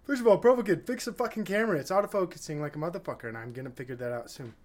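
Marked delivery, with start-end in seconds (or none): 0.0-1.8 loud, high mock heckle at the host; imitating a viewer shouting; mock viewer yell. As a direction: bark it like an annoyed viewer. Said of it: "you're like".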